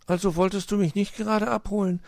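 high-voiced, disappointed little fox; high, mildly hurt question; saddened. A narrator's reading. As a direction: ask sadly, like a let-down child. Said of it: "war Weißöhrchen traurig".

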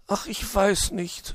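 high, slow, reluctant magpie voice; drawn-out, high storybook sigh. A narrator's reading. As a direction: sigh the words out hesitantly. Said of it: "seufzte sie".

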